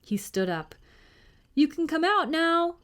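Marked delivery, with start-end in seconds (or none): 1.5-2.8 high, raised call to someone hidden. A narrator's reading. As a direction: call up so the hidden knight hears. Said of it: "William called to Sir Simon".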